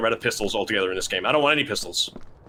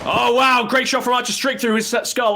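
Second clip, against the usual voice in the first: excited caster shout; 10 semitones higher than usual. Real, not acted. Caster reacts to great shot.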